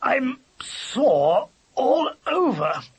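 high, slow, drawn-out moan; aching complaint. A narrator's reading. Stiff dog stretching after waking, aching complaint. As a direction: groan it out, stiff and sore.

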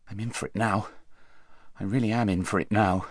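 hushed, private dread; soft voice of a thought, with understated apprehension; inward thought. A narrator's reading. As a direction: think it softly to yourself. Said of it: "thought Shasta".

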